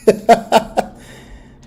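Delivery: high, loud laughing words; loud laugh-filled exclamation; laughing hard. Real, not acted. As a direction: crack up while saying it. Reactor bursting out laughing.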